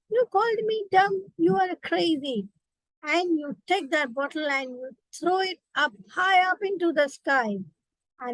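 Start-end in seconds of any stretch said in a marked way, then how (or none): 0.1-2.5 higher, playfully offended comeback; mock upset retort. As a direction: snap back at the bottle in fun. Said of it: "you get upset at the bottle".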